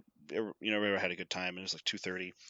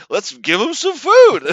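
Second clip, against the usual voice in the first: much higher and louder put-on voice; mock worker voice; 11 semitones higher, 13 dB louder than usual. Imagined worker suggests the plan, high and loud. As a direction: pitch it like a silly scheme.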